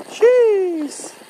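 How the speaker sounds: high exclaimed gasp at the gradient; startled high jeez. Real, not acted. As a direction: blurt it out, shocked by the steepness. Steep climb on the bike.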